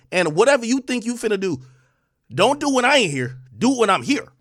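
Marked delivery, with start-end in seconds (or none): none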